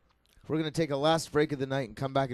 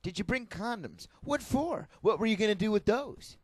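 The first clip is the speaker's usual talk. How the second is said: mock interrogation with pitch jumping up; Chris Hansen; 4 semitones higher than usual. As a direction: feign disbelief like a sting-show host. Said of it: "read them in his Chris Hansen-y voice".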